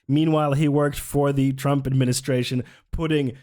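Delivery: normal talking voice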